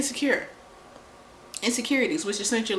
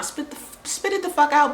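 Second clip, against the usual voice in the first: irritated demand; 9 semitones higher, 5 dB louder than usual. Retold or imitated.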